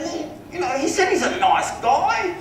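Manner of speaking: very high, drawn-out affected voice; Philip Harding, wealthy client. Retold or imitated. Homeowner explains choosing another agent, high and affected. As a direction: mimic a rich man airily explaining himself.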